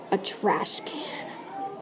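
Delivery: whispered reveal. A narrator's reading. Spooky reveal of watcher.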